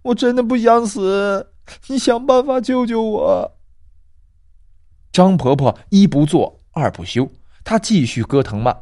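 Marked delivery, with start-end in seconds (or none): 0.0-3.6 high, tearful girl with a hint of sobbing; crying. Girl crying to grandma for rescue, lightly tearful. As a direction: beg through mock tears. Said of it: "她哭着说".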